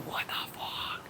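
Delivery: hushed, breathless whisper; whispered what the fuck; whispered disbelief. Retold or imitated. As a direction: whisper it in stunned awe. Stunned reaction in retold moment.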